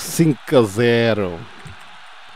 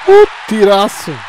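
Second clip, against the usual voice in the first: high burst of laughter into a loud cry; laughing shout; 5 dB louder than usual. Real, not acted. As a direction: laugh out the shot. Laughing at a great shot. (riso e grito)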